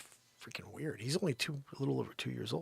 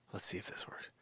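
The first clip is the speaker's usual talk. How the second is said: whisper; 5 semitones lower, 11 dB quieter than usual. Real, not acted. Aside in whisper.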